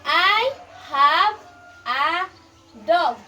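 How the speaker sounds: word by word, spaced out; slow school dictation. Shown as a demonstration. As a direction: model it slowly for the child. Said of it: "I will read it again".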